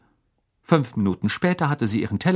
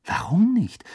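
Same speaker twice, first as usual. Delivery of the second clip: high, puzzled question; taken-aback query; surprised; 10 semitones higher than usual. A narrator's reading. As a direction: let the pitch jump in surprise. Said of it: "fragte Hermine überrascht".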